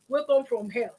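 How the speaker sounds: ordinary delivery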